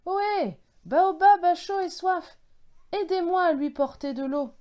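high, loud call for help. A narrator's reading. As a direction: shout to people far off to come and help. Calling others for help.

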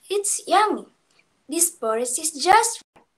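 put-on a delighted little girl tasting her porridge; said happily. A narrator's reading. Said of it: "she said happily".

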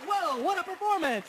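high, loud amazement; mock-dazzled fan; awed exclaim. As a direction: marvel at the show. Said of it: "And I was like".

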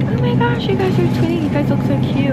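high-pitched gushy squeal, easing down; imitated gushing lady. Lady admiring the twinning outfits, gushing. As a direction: imitate a stranger cooing over twins.

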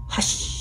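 soft, drawn-out hush; long quiet shhh without voice; sound of snow falling. Shown as a demonstration. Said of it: "Do you want to try that?".

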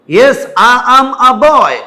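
loud, high, every word drawn out; rude over-emphasis. Acted. Learner being rude to someone by over-pronouncing, loud and slow. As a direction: belt out each word separately, insolently. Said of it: "বেয়াদবি পর্যায়ে করে ফেলছেন".

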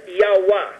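"Yahweh" said as Hawaii read backwards; "Yahweh" sounded out in two syllables; pronunciation demo. Shown as a demonstration. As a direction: say "Yahweh" as YAH-weh. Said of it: "can be read backwards as".

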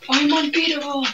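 boast. Real, not acted. Boasting in game.

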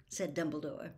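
ordinary delivery

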